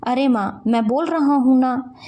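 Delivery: plain reading voice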